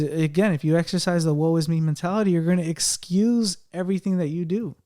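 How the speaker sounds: usual speaking voice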